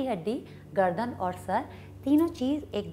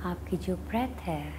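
guided meditation; 2 semitones lower than usual. Acted. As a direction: guide them unhurriedly into watching the breath. Leads a meditation.

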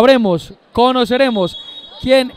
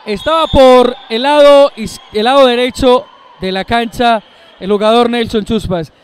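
excited; 8 dB louder than usual. Real, not acted. Co-commentator reacts to goal.

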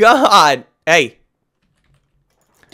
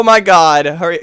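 normal talking voice

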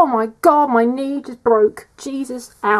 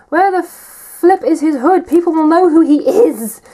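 indignant moan; 6 semitones higher, 8 dB louder than usual. Real, not acted.

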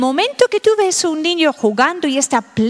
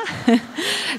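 soft laugh; 6 dB quieter than usual. Real, not acted. Laughs mid-advice.

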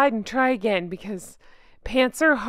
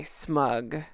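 smug caption; 9 semitones lower, 8 dB quieter than usual. Pretend. One-word smug caption.

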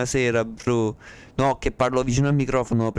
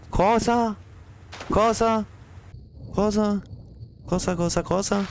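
very high squeaky repeated disbelief; shocked by what he just read, pitch shooting up; incredulous high-pitched what; disbelieving what?; 10 semitones higher than usual. Real, not acted. Reading baffling chat message.

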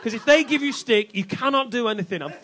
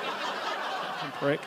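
usual speaking voice

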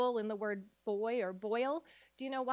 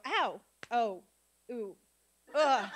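lightly acted little cries, ending on a louder ugh; pretend mosquito reaction; 3 dB louder, slower than usual. Teacher pretending a mosquito landed on her, mock reaction. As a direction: play at being bothered by a mosquito, sound by sound. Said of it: "I pretend a mosquito landed on me".

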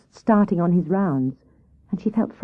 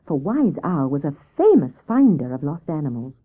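ordinary delivery

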